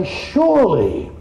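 drawn-out, lofty "Surely"; mock pious. Preacher mimicking a pious prefacer, lofty and slow. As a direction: sneer the word out like a stuffy saint.

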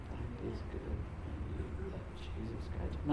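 soft, hushed, almost breathed at the end; gentle quiet invite. Shown as a demonstration. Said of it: "we can gently invite them".

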